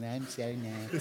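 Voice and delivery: put-on soft, slow little girl voice, laughing; preacher mocking the girl's sweet voice. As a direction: coo it like a sweet child. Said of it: "little bitty girl going".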